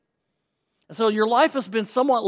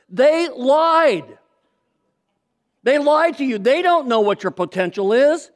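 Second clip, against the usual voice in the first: louder and higher, hammering "lied"; forceful declaration; 5 semitones higher, 5 dB louder than usual. Real, not acted. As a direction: proclaim it to the whole church. Preacher's emphatic declaration.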